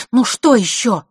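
loud, high, curt question; rude. A narrator's reading. Vasilisa asks her brother what else, rudely. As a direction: ask it with rude impatience. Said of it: "грубо спросила она".

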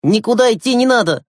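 determined, emphatic refusal; decisive. Acted. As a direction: overrule them with resolve. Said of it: "решительно произнёс Глеб".

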